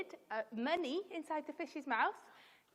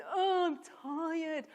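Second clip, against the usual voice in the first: loud, slow, higher whine; hammed-up grumbling; 5 semitones higher, 8 dB louder, much slower than usual. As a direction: moan it out loudly, dragging each word. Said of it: "they started grumbling".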